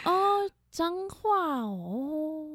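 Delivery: slow, drawn-out high mock voice; mock Taipei reaction. Friend mocking her hometown, slow drawn-out voice. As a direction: stretch it out like a snooty city friend.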